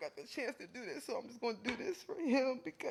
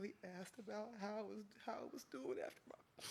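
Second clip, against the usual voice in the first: normal talking voice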